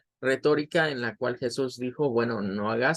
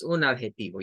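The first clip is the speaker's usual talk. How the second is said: usual speaking voice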